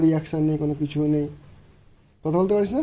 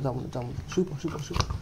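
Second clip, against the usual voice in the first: soft lulling; 3 semitones lower, 8 dB quieter, much faster than usual. Real, not acted. Lulling the dog to lie down.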